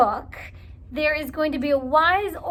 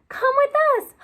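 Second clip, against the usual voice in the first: eager invitation; 12 semitones higher, 5 dB louder than usual. Acted. Invite otter.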